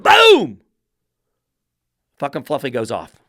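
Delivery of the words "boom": loud boom. Real, not acted. Dog suddenly explodes.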